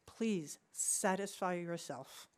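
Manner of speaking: ordinary talk, nothing marked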